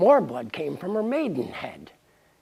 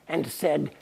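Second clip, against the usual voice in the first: plain reading voice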